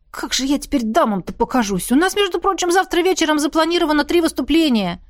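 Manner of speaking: theatrical dismay; wailing complaint. A narrator's reading. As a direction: lament your ruined looks indignantly.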